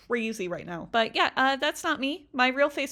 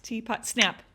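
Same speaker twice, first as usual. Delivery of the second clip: usual speaking voice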